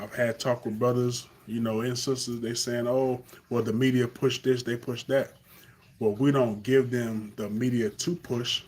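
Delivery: ordinary talk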